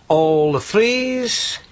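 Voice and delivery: slow drawn-out bingo call; bingo caller. Acted. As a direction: call the numbers like a bingo hall. Ron calling bingo numbers.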